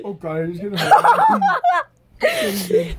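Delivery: very high, slow, laughing squeal; high, slow, giggling mock panic. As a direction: cackle it out in a high mocking squeak. Mocking high laughing puppet line.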